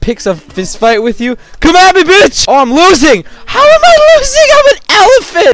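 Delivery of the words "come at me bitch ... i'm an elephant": high, loud outraged yelling; loud taunt then panic. Pretend. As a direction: squeal in disbelief, losing. Elephant losing the fight.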